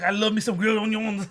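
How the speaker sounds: laughing Louisiana cook impression; imitated Louisiana accent. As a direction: mimic the cook's Louisiana accent, amused. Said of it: "he said it too".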